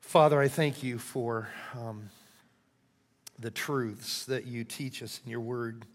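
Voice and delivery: soft, halting spoken prayer. Real, not acted. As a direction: pray quietly with long pauses. Leads a closing prayer.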